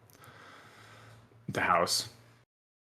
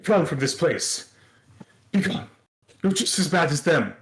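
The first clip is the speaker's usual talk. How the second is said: higher, louder scream to get out; 6 semitones higher, 4 dB louder than usual. Acted. Shopkeeper screaming at the stranger in the doorway, loud and high. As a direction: shriek at him to run and be gone. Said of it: "She just starts screaming".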